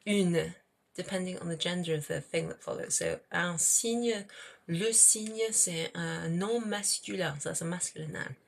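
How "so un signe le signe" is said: ordinary talk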